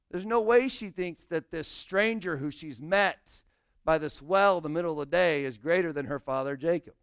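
ordinary talk, nothing marked

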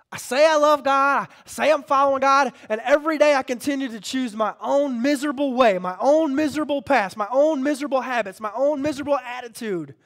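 high, loud confession from the pulpit; full-force preacher's cadence; impassioned preaching. Acted. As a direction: preach it loud, piling up each failing.

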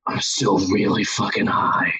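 low, dazed confession; stoned dad. Retold or imitated. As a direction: drop low, spaced out. Stoned reply to excited child.

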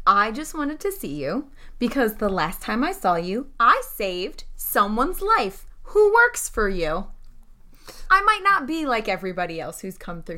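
ordinary talk

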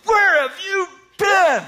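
loud, very high, drawn-out mock yell; amped angry yell. Angry dad confronting the late girls, amped yell. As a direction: shriek each word slowly like a furious husband. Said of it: "amped. I'm like".

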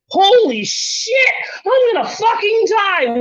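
high-pitched spiral of panic rising to a yell; a high, strained panic that ends in a shout; mock panic, building to a shout. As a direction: act out a freak-out that climbs from wondering to screaming. Reenacting spiralling panic while high.

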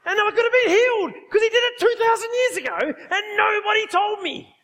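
loud, high-pitched indignant woman; mock outrage. Furious lady grumbling about healing nobody told her of, mock outrage. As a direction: retell her angry rant, voice pushed high and loud. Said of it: "she was so mad, she's muttering out".

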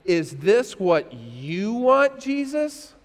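slow, searching question, stretched on YOU; drawn-out, deferential asking; earnest question. Acted. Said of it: "this is the big one right here".

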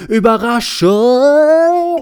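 high, loud, drawn-out cry of surprise; shouted. A narrator's reading. Überraschung bursts in with a startling yell. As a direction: belt it out so it startles even her. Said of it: "rief sie und erschreckte sich".

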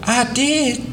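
put-on high, eager little boy; a proud little boy pipes up; small boy voice. A narrator's reading. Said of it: "declared Doodle".

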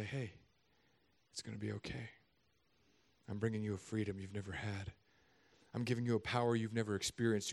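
soft, low, tender voice; gentle reassurance. Acted. God wrapping His cloak around the man, soft and tender. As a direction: comfort him quietly and tenderly.

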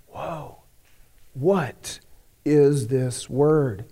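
hushed, slow, awed wonder; amazed whisper. Acted. Crowd in the synagogue, hushed and awed. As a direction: marvel at what you just saw.

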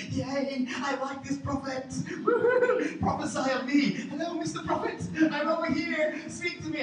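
high, loud and giddy, laughing through it; eager excitement. Retold or imitated. Pastor hoping the prophet picks him, giddy and eager. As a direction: squeal like a kid begging to be picked. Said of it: "I remember sitting there going".

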